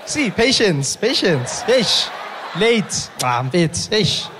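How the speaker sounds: loud, high exasperated sighing words. Acted. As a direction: scold the latecomers. Scolding latecomers.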